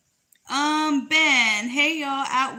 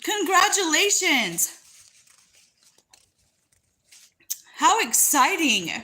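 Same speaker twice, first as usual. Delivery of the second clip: excited; 5 semitones higher than usual. Real, not acted. Congratulating on second baby.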